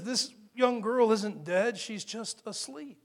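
calm slow statement. Acted. Jesus's statement to mourners.

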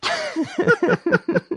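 slow, laughing through the words. Real, not acted. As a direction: laugh out the echo. Repeats line while laughing.

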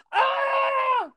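high, loud yelp of pain; sharp pained yelp. Retold or imitated. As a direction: yelp as if hit in the shin. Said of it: "I was like".

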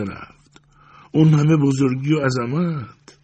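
put-on old woman crying out to God, pitch climbing high; awed lament. A narrator's reading. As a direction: marvel aloud at such humility.

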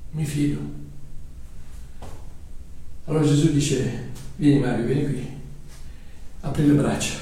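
hushed tender narration. Acted. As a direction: draw listeners into the moment. Tender climax of sermon story.

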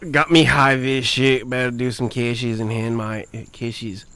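broad mock Australian tradie talk; Australian accent. Shown as a demonstration. Tradie on cash jobs, mock Australian. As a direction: ham up a broad Aussie accent. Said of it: "do the accent right for an Australian".

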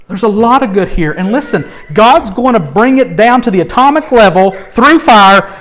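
ordinary talk

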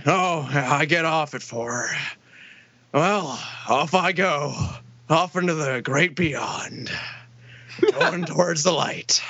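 put-on slow, drawn-out old man voice; Bert. Old man leaving work at four, slow creepy old voice. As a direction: imitate an old man's ominous farewell, pitched low. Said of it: "there's this old guy who works there".

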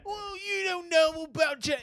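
high, slow mock-British voice; drawn-out, hammed-up British; bad British accent. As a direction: squeak out a badly faked British accent. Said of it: "I can't do a British accent".

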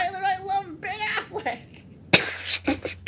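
high, slow, laughing mock voice; mocking impression. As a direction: whine it in a silly mocking voice. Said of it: "That's what you sound like".

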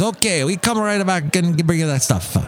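put-on Italian accent. Italian waiter promising the food, mock Italian accent. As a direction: take the order away in a put-on Italian accent. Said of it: "that's my Italian impression".

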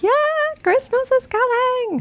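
high, slow, gleeful squeal; excited elf voice. Retold or imitated. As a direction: sing it out like a giddy elf. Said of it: "And I'd be like".

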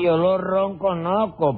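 "reconozco" deformado en "ronconoco", despacio; palabra mal pronunciada. Acted. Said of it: "que se dice así". ("reconozco" estropeado con "ron" inicial y sin la zeta)

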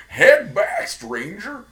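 put-on high old prospector warning; old-timey miner. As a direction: warn off like a crazy old miner. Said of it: "quoting miner bit".

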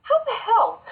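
high, disbelieving outcry; can't believe it; incredulous. Real, not acted. Outraged at the ranking.

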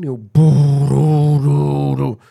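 loud mock heavy guitar riff "boo doo doo doo"; heavy riff imitation. Imitating the heavy bridge, loud booming riff.

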